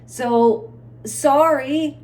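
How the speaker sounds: loud apologetic shout. Real, not acted. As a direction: shout out a quick sorry. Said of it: "why am I shouting?".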